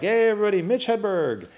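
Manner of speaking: high, stretched announcer call; imitated emcee introduction, pitched well up; stand-up emcee. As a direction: bring on the next comic like a club host. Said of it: "but the guy's like".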